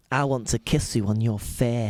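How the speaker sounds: broad put-on Hull vowels; Hull accent. Host showing the sexiest accent, flirty mock Hull. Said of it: "Hull is the sexiest accent".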